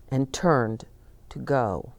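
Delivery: ordinary delivery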